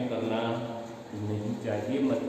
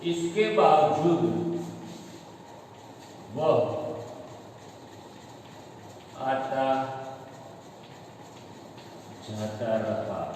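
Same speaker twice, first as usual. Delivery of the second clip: slow correct example; 4 semitones lower, 5 dB quieter, slower than usual. Shown as a demonstration. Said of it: "सही वाक्य क्या बनता है?".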